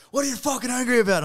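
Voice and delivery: furious yell. Retold or imitated. Facilitator in his face at the retreat, yelling furiously. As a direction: yell it high and loud, right in his face. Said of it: "You're like".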